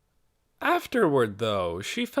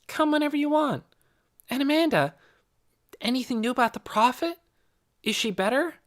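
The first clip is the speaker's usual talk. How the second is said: put-on high-pitched, inquisitive child; Nicky, eager girl; 13 semitones higher, faster than usual. A narrator's reading. Girl asking a friend about the prophet, high and eager.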